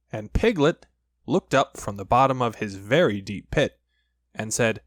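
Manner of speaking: usual speaking voice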